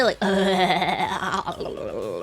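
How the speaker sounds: a wet slurping noise; odd slurping noise. Real, not acted. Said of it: "I shouldn't make that noise".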